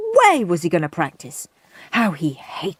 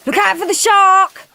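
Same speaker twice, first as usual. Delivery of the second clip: mock warning shout; 12 semitones higher, 7 dB louder than usual. A narrator's reading. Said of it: "said Horrid Henry".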